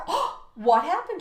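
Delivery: usual speaking voice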